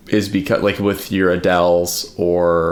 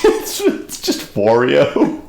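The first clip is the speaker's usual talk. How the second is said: amused; 6 semitones higher, much slower than usual. Real, not acted. Joke punchline, slow high.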